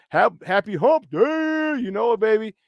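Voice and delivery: long show-host holler; hyped greeting. Real, not acted. Hyped greeting to chat viewers.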